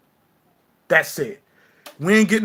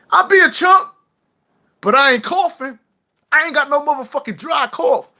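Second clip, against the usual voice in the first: mock commenter voice; 11 semitones higher, 6 dB louder than usual. Mocking a commenter's taunt.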